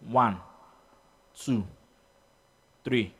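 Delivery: slow count with long even gaps; steady clock-paced count. Shown as a demonstration. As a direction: count along with a ticking second hand. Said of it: "following the second hand time and be counting".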